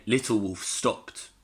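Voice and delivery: ordinary delivery, nothing marked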